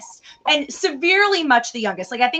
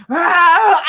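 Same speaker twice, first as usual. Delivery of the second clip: mock pained cry; 5 semitones higher, 9 dB louder than usual. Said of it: "like going".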